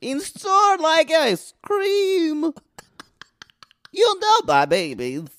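slow, high, wobbling put-on voice; high modulated voice. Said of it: "there's kind of a modulation with the voice".

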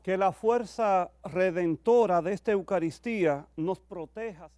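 loud, measured prayer intonation; liturgical prayer. Acted. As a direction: pray aloud to the whole church. Priest reads closing prayer.

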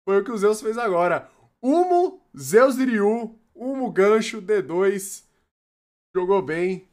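high, loud hype; excited. Real, not acted. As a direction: still buzzing about the play. Hyped commentary of the play.

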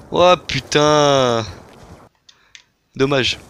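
ordinary talk, nothing marked

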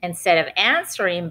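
usual speaking voice

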